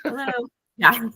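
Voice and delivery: usual speaking voice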